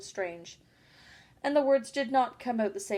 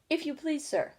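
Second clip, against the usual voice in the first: higher-pitched, timid polite appeal; 6 semitones higher than usual. A narrator's reading. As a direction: ask meekly, as if nervous of the listener. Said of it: "she began in a low, timid voice".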